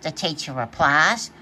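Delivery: ordinary delivery, nothing marked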